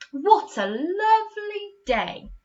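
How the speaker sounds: very high, slow gushing cry; delighted exclamation. Shown as a demonstration. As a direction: gush about the lovely day. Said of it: "put a bit more emphasis".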